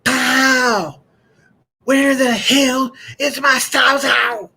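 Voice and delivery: high, loud country-dad bellow; mad dad yelling. Acted. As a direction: holler it slowly like a furious West Virginia father. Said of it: "he was so mad, and he said".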